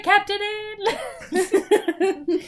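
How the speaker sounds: high, laughing mock lament; laughing mock regret. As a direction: joke as Joe while laughing. Joking as Joe, laughing.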